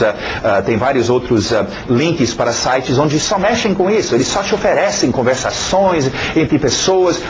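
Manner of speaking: ordinary talk, nothing marked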